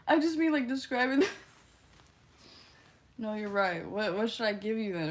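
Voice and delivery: ordinary talk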